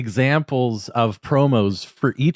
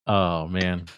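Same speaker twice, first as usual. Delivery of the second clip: normal talking voice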